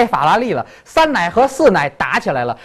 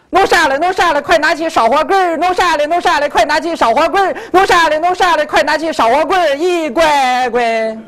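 Henan dialect; 8 semitones higher than usual. Shown as a demonstration. Showing a child the song in Henan dialect, Henan accent. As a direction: chant it high in a broad Henan accent. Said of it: "河南话是这么说的".

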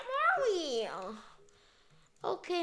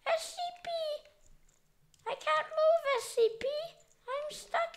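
high, pleading little voice; high pretend distress; whiny squeak; 13 semitones higher than usual. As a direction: whimper like a trapped kid. Stuck, pleading in game.

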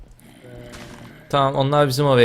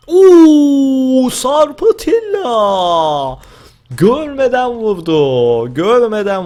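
mock wail; 10 semitones higher, 7 dB louder, much slower than usual. Wailing after a blind hit.

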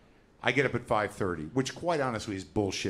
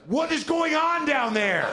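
much higher, louder, slow panicked yell; frantic guest shouting down to the front desk; 6 dB louder, slower than usual. Retold or imitated. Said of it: "And I go".

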